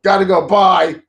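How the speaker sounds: high, loud, chirpy goodbye; imitated breezy farewell. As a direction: sign off abruptly and brightly. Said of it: "She says".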